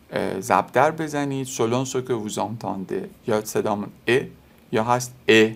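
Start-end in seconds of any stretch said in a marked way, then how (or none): none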